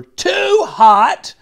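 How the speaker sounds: "too" stretched and loud; complaining stress. A narrator's reading. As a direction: lean hard on "too" in "too hot". Child complaining about supper.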